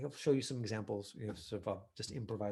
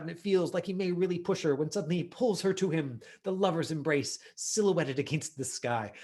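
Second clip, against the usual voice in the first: rising, louder dramatic read-through; dramatic scene reading; 3 semitones higher, 7 dB louder than usual. Acted. Coach reading the audition write-up to the class, dramatic. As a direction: build the scene louder as it climbs.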